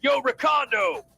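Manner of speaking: calling out. Acted. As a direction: shout his name slowly. Calling out to squad mate.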